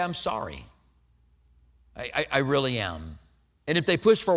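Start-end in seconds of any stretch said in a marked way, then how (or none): 0.0-3.2 soft, low, heartfelt sorry; mean every word, quietly; sincere apology. Shown as a demonstration. Preacher showing a plain heartfelt apology, soft and low. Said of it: "Try to just say".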